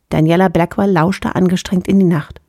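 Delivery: usual speaking voice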